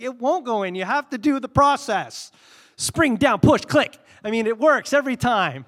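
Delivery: loud, high-pitched frustrated insistence; mock-exasperated outburst; acted exasperation. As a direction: argue heatedly, as in a retold squabble. Acted quarrel over fitting batteries.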